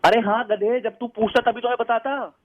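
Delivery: normal talking voice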